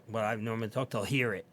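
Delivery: usual speaking voice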